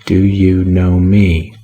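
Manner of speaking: slow, level repeat with no new emphasis; same even repeat. A narrator's reading. Wine-shop keeper repeating to the banker, slow and even. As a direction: repeat it word for word, unmoved. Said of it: "without any change of emphasis".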